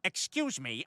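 ordinary delivery

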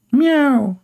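high mock meow; playful high cat meow. Imitates a cat.

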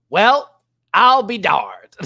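loud, high, drawn-out farewell punchline; theatrical exit line. Acted. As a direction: project it big and slow as a parting line. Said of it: "this should have been the exit line right here".

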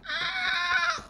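sudden high yelp of fright; startled scream. Real, not acted. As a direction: shriek in shock.

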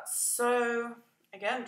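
ordinary talk

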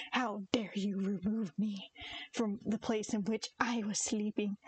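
slow, mock-outraged cat voice; indignant cat. Owner voicing her disturbed cat, slow mock indignation. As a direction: play an affronted cat, drawing the words out. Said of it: "so aggressively. He's like".

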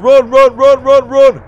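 loud, high, driving repetition; panicked urging. Real, not acted. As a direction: urge yourself on in a panic. Fleeing the monster.

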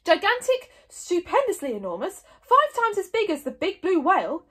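high, loud, gleeful piling-up; happy interruption. A narrator's reading. Old Wrinkly interrupting Stoic with superlatives, gleeful. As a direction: relish each bigger word excitedly. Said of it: "interrupted old Wrinkly happily".

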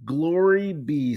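slow, delighted exclamation; happily. A narrator's reading. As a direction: savour it like wonderful news. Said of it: "said the Frizz happily".

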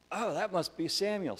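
put-on high, loud, eager boy; young boy Eli. Boy woken at night guessing who called, high excited voice. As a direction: exclaim it like a child suddenly working it out. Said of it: "And Eli goes".

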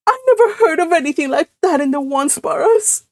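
high, tearful protest; sobbing. A narrator's reading. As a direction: sob it out, wounded. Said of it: "she sobbed".

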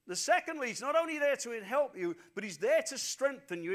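loud, driving sermon delivery; preaching at full force. Real, not acted. Pastor urging listeners that the Spirit helps them, loud. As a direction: press the promise home to the crowd.

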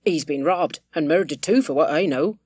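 ordinary delivery

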